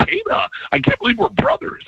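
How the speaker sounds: high, playful retold line; high mock delight. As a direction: squeal it like a proud brother. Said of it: "And I'm like".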